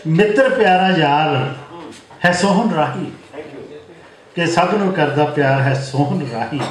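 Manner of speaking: lilting mushaira couplets; poem recitation. Acted. Poet teasing his eighty-year-old friend in verse, lilting recitation. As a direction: recite line by line with pauses for the audience.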